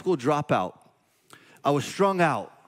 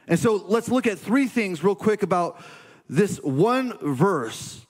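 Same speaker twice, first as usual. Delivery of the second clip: higher, energetic sermon delivery; preaching; 5 semitones higher than usual. Acted. Pastor urging listeners to their purpose, fervent preaching. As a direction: preach it to the room with conviction.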